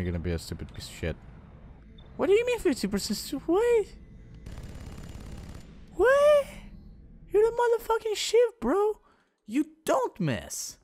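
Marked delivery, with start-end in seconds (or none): 2.2-10.8 outraged yell. Real, not acted. Soldier misses easy shot.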